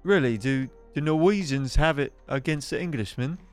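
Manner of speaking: high-pitched English character voice; politely puzzled, high-voiced townsman; Nottingham local. Local remarking on the newcomer's inflection, high English character voice.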